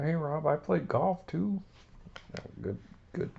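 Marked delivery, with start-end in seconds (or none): 0.0-1.6 high, eager goofy voice; Gerald Ford puppet voice. Acted. Gerald Ford telling Rob he golfs, high goofy voice. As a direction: chime in proudly, pitched up. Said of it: "good job, Mister Ford".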